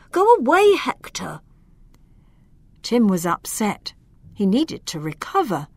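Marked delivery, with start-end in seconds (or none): 0.1-1.4 high, loud go away; frightened shout. Acted. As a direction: shoo him off in panic. Tim sends Hector off.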